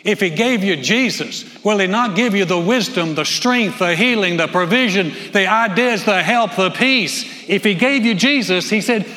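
loud, rising preacher's call; full-force preaching. Acted. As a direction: preach it out to the congregation.

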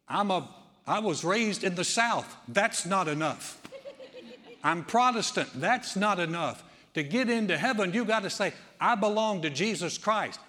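loud, ringing pulpit preaching; preaching at full force. Acted. Pastor rousing the congregation, preaching loudly. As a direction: preach it out to the whole room. Preacher drives point home.

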